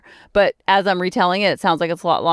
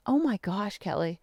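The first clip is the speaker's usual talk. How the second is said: much softer, sinking self-reproach; hushed dismay; 3 semitones lower, 11 dB quieter than usual. Retold or imitated. To herself after hearing he's grieving, hushed dismay. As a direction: say it small, under your voice. Mortified on hearing of his loss.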